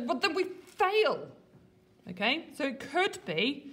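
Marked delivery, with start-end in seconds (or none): 0.0-1.3 exasperated, higher and louder, slowly; frustrated. Retold or imitated. Lady Macbeth fed up with her husband, exasperated. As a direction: snap it back, fed up with his dithering. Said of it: "so flipping frustrated with him".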